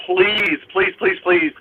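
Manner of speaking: ordinary delivery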